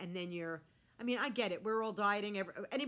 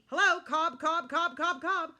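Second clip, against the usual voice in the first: loud, higher, punched repeated beats; exasperated exclamation; 8 semitones higher, 10 dB louder than usual. Real, not acted. Vlogger counting carbs on a cheat-day plate, exasperated. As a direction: hammer each word out in disbelief. Mock outrage listing carb-heavy foods.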